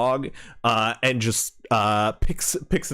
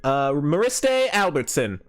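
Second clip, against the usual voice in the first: high, hearty drawling hunter-woman voice; folksy frontier woman; 10 semitones higher than usual. Acted. GM voicing the lodge owner greeting the party, hearty high folksy voice. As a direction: greet the travelers like a friendly backwoods proprietor.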